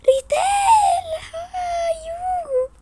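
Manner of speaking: high, stretched delighted greeting; drawn-out happy squeal; excited squeal. Acted. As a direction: squeal her name like a long-lost friend.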